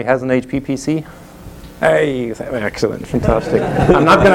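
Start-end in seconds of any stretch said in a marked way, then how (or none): none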